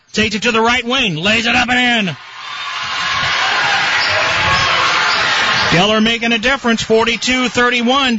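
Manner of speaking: rising, fast, excited call; excited play-by-play. Real, not acted. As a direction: build the excitement on the run. Excited run by the Cats.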